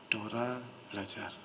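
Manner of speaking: low, hushed, emotionless tone; heavy, quiet hypnotic demo; soft flat suggestion. Shown as a demonstration. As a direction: murmur it low and level, with weight. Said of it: "belajarnya intonasinya agak berat".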